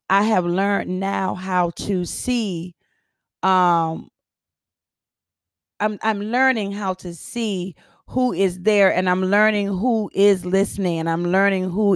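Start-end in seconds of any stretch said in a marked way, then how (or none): none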